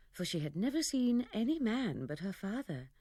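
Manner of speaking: plain reading voice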